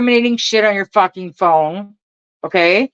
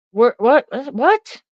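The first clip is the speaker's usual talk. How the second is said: incredulous; 7 semitones higher, slower than usual. Real, not acted. Reacting to phone scanner news.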